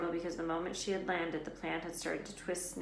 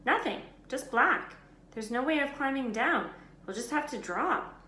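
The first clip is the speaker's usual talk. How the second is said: Ron; 7 semitones higher, 7 dB louder than usual. A narrator's reading. Ron calling up from trapdoor.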